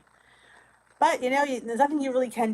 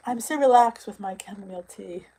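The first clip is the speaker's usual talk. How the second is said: ordinary talk, nothing marked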